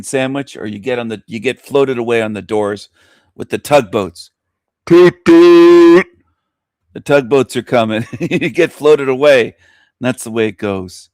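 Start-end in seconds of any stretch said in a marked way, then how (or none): 4.9-6.1 high, loud mock horn blast; a cartoon tugboat hooting; tugboat horn toot.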